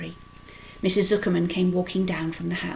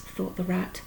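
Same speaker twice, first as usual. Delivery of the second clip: plain reading voice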